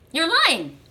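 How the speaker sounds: indignant, pitch jumping up; accusing. Acted. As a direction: throw it at them as an acted charge. Acted accusation in couple quarrel.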